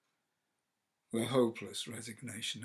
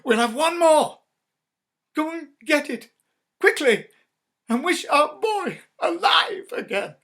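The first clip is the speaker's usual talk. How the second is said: very loud, high urgent orders; frantic command; 18 dB louder than usual. Acted. Wife orders her husband to wish, very loud and high. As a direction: beg and command at once.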